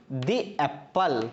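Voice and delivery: "the एप्पल" as dee, then ap-pal. Shown as a demonstration. Teacher giving the correct answer, "the एप्पल" as dee ap-pal. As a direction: say "the" in "the एप्पल" with long ee. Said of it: "इसको बोलेंगे हम".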